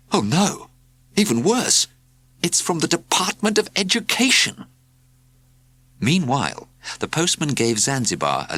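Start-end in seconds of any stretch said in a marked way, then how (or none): 0.1-4.7 alarmed. A narrator's reading. Alarmed discovery.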